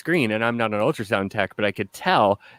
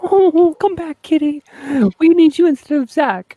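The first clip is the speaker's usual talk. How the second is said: high, louder pet-talk voice; calling the kitty; 7 dB louder than usual. Real, not acted. Begging the cat to return, high coaxing voice. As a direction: coax the cat back in a high sing-song.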